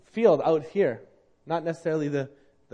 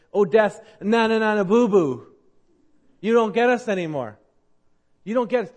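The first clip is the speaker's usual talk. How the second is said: loud, raised playground jeer; crowing, childish mockery from the pulpit; mocking taunt; 6 semitones higher, 7 dB louder than usual. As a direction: taunt a beaten enemy in singsong triumph. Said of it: "to mock it, to say it".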